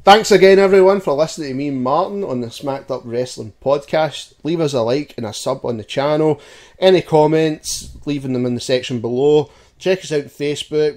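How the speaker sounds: loud, upbeat sign-off to listeners; podcast outro. Acted. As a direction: wrap up the show like a host.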